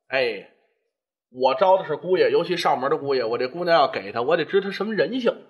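put-on low-pitched old patriarch weighing things up; shrewd father reasoning. 周三爷暗自盘算试探女婿. (压低嗓音，精明盘算)

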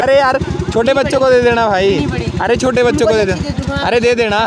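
high, loud laughing refusal; excited protest. Real, not acted. To the kids handing him chocolate, a high, loud protest.

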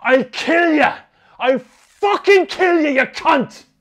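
high, loud screamed threat; repeated screaming. Retold or imitated. Schoolboy screams at the bully in his grip, furious scream. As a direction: scream it in a rage, drawing out the second threat. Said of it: "I screamed at him repeatedly".

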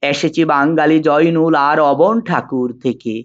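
measured, sustained poetry recital with drawn-out phrase endings; poem recitation. Acted. Teacher reciting the poem Amar Porichoy for students, in measured declamation. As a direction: declaim the verse slowly and evenly, pausing between couplets.

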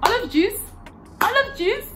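exaggerated Scottish accent, high and loud. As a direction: belt it in a mock Scottish lilt. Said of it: "it sounds like when they say".

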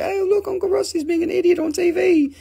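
put-on high childlike kid voices; high-pitched mock children; imitating nieces and nephews. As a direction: play the giggling nieces teasing uncle. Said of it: "They come in and they say".

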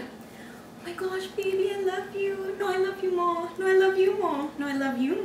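soft, high mock sweetheart voice; mock lovey-dovey. Mocking roommate's late-night calls to boyfriend, soft high lovey-dovey. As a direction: imitate a lovesick phone call, high and hushed.

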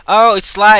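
ordinary delivery

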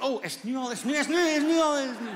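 unsure when to start talking; nervous. Retold or imitated. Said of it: "omdat je niet wist van".

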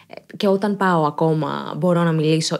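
normal talking voice

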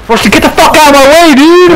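high-pitched, indignant yell at a teammate; exasperated outburst. Real, not acted. Player to teammate blocking the way, exasperated outburst. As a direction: let irritation push the voice right up.

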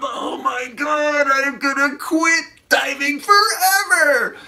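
high, drawn-out strained shout; hammed-up screaming despair; exasperated mock scream. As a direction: wail it at the end of your rope. Acting out his own exasperated reaction.

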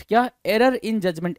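ordinary talk, nothing marked

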